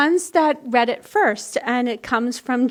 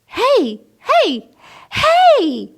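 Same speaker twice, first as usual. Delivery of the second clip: rising yell, each hey higher and louder; frog yelling; 11 semitones higher, 7 dB louder, much slower than usual. A narrator's reading. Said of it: "yelled the frog".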